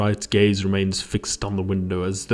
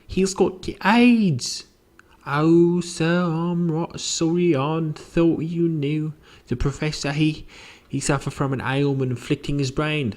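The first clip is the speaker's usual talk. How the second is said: apologetic housekeeper; 8 semitones higher than usual. Acted. Housekeeper telling visitors of his illness, high and apologetic. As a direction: break bad news in a high voice.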